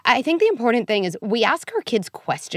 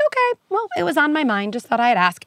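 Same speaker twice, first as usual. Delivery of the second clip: breezy nonchalance; 7 semitones higher than usual. Pretend. Parent answers breezily.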